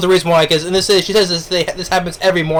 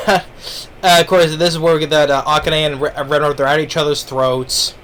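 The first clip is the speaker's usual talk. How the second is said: ordinary talk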